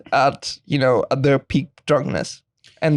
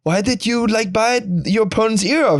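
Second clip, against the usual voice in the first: interviewer voice; 7 semitones higher than usual. Retold or imitated. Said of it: "he was like".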